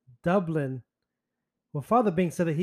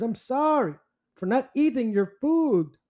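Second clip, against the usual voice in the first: mock apology; 7 semitones higher than usual. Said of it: "that's not really being sorry".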